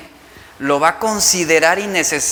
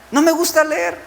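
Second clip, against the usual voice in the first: put-on much higher whiny child voice; 9 semitones higher than usual. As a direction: whine "No me gusta leer" high with stress on "me". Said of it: "Es lo que te van a decir tus hijos". (niño quejumbroso con voz aguda)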